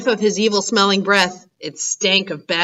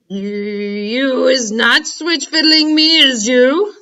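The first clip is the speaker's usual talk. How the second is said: slow, louder, suspicious giant; 4 semitones higher, 4 dB louder, slower than usual. Acted. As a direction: drag it out, eyeing him.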